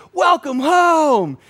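high, slow, loud gushing welcome; a girlfriend's delighted high cry of welcome; excited welcome shout. Retold or imitated. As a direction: throw open your arms and squeal it out. Girlfriend bursts out welcoming them.

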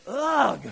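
loud, high "ugh" of distaste; exasperated groan. Acted. Pastor on sitting through church for nothing, exasperated groan. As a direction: groan it out loudly.

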